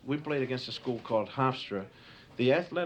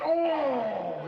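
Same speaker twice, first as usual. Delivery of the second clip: pained cry; 10 semitones higher, 3 dB louder than usual. Retold or imitated. Hit in the groin.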